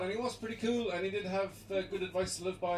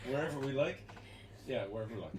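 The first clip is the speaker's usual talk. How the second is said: each repeat lower and softer; fading repeat; 5 semitones lower, 6 dB quieter, faster than usual. Shown as a demonstration. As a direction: let it fade away.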